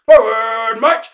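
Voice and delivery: drawn-out drill shout; hollered command. Acted. Veteran to surviving soldiers, hollered marching order. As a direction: bellow it like a drill call. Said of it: "he hollered".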